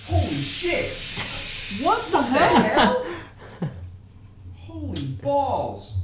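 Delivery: loud, high drawn-out exclamations; real startled yelling; shocked outburst. Real, not acted. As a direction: cry out in real shock at the mess. Homebrew beer explodes on opening.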